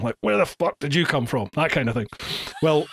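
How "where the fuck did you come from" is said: usual speaking voice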